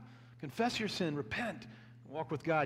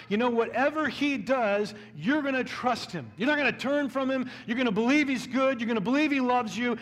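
louder, higher, driving sermon voice; preaching at full force; 4 semitones higher, 4 dB louder than usual. Real, not acted. Pastor urging the congregation to trust God, preaching at full force. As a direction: press every line home to the congregation.

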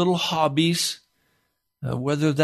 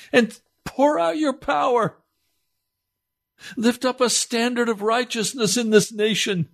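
pleading preacher, pushing higher as it builds; impassioned preaching; 5 semitones higher than usual. Acted. Preacher pleading with listeners for the lost, impassioned. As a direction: urge the listeners to cry out, voice rising.

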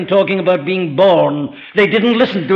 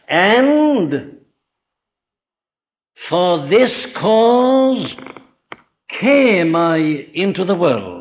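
very slow, each word of "for this cause" set apart with long pauses; solemn weighty quote; much slower than usual. Acted. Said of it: "Listen to him".

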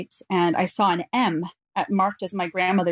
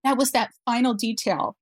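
higher, halting voice close to tears; tearful; 4 semitones higher than usual. Real, not acted. Mother explaining to interviewer why this part makes her cry, tearful. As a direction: explain it while recovering from crying. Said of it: "I still cry when I tell that part".

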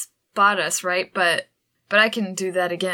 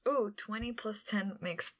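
whisper; 13 dB quieter than usual. Real, not acted. Whispering dice roll.

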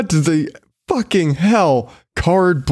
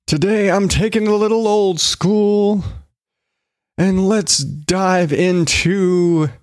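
slow, drawn-out show intro, pitched up; hammy show announcer; 5 semitones higher, slower than usual. Acted. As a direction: build up the news segment.

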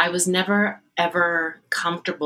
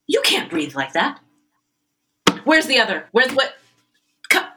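impatient rehearsal scolding; 6 semitones higher, 5 dB louder than usual. Retold or imitated. Conductor scolds singer in rehearsal.

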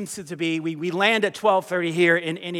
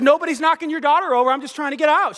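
high-pitched, exasperated comeback; defensive protest; 6 semitones higher than usual. Retold or imitated. Passenger answering an angry mother, defensive. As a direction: act out protesting your innocence.

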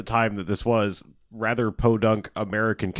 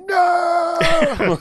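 high, loud, stretched-out movie scream; mock the hammed-up slow-motion "No"; 5 dB louder than usual. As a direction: parody of an action hero's drawn-out howl. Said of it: "a lot of slow motion of him going".

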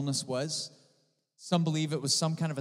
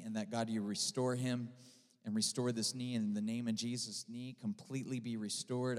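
low, soft, earnest praying voice; simple prayer; 4 semitones lower, 6 dB quieter than usual. Retold or imitated. Preacher prays over his brother's injured knee, softly and simply. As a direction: pray gently and plainly, voice dropped. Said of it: "I'm praying this simple prayer".

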